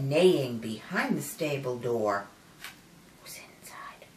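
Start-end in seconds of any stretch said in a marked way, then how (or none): 3.2-4.1 breathy suspense whisper. Acted.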